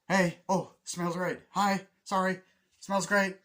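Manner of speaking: high, loud, hurried call-outs; mock passing greetings. Runner cutting through someone's house, mock breezy shouting. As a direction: toss out cheery hellos mid-dash.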